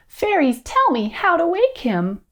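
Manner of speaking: high-pitched storybook princess; pleading Beauty. A narrator's reading. Beauty asking fairies to wake the prince, high plea.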